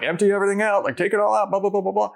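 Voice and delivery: high, hammed-up rattle of orders; mock security spiel. To his fiance, mocking the strip-search routine, hammed-up and high.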